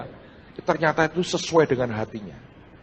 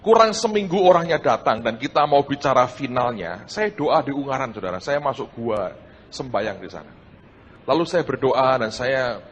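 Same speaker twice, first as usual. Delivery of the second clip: ordinary talk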